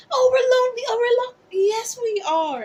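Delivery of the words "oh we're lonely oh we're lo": imitating whiny complaining; high, loud mock wail; whiny mock lament. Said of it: "people be saying that all the time".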